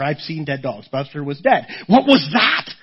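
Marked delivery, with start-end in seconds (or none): 1.9-2.7 higher, louder startled question. Retold or imitated. Preacher voicing the shock of the moment, startled. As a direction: ask it with a jolt.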